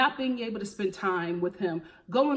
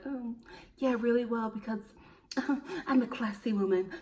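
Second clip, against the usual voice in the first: soft, lightly haughty mock voice, laughing in between; mocking Courtney; 4 dB quieter than usual. Vlogger imitating Courtney's smug classy line, soft and mocking. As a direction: lightly send up her smug tone. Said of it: "she would do this thing where".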